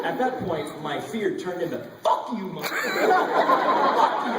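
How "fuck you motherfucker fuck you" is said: screaming at the hippos, much higher and louder; mock furious yell with a laugh in it; furious shout. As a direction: roar it out in livid defiance. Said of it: "I was fucking livid".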